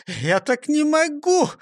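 high, drawn-out whine of complaint; complaining. Acted. Sneezing robber complains to the chief, high slow whine. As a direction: stretch the complaint out, pitched high. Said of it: "пожаловался Ниса Чих".

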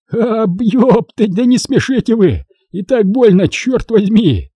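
high, drawn-out pained moan; groaning through laughter. A narrator's reading. Wounded soldier begging friends not to make him laugh, groaning. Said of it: "простонал со смехом качок".

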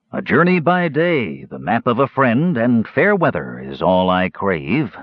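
slow, lilting recitation, falling at the end; drawn-out singsong verse; recited verse. A narrator's reading. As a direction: declaim it like a traveler's poem.

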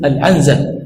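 العنزة said "al-'anza", n closing the syllable; sukun on nun. Shown as a demonstration. Teacher naming the misreading for students, "al-'anza" with vowelless nun. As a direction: say العنزة as al-'AN-za. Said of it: "تسكين النون".

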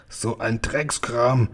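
slow, drawn-out grumble through food; mock mouthful of food; spluttering, mouth full. A narrator's reading. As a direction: splutter it as if chewing. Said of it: "sagte er prustend mit vollem Mund".

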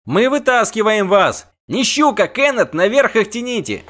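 high, loud shouted instructions; shouting. A narrator's reading. Bard directs the rope rescue, shouting. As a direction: holler orders from above. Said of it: "крикнул сверху Лютик".

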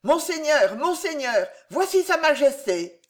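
high, urgent announcement; crying out. A narrator's reading. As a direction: call out breaking news to your lord. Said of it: "un homme cria".

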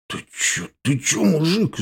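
faltering start, then high-pitched alarm; scared, breath-first protest; frightened. A narrator's reading. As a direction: stammer it out, scared. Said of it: "испугался Серый".

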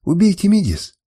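high, desperate narrated wail; wailing plea. A narrator's reading. Woman begs to die in her lover's place, wailing. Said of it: "причитала она".

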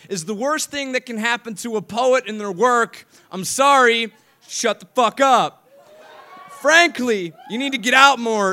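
high, forceful spoken-word delivery; slam poem. Acted. Poet rebuking critics of happy poets, forceful slam recital. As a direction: launch loudly at the doubters, then ride the rhythm.

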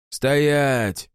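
sharp shouted command. A narrator's reading. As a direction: yell it from the dark. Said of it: "проорал кто-то из темноты".

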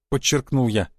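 plain reading voice

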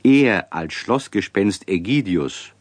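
ordinary delivery

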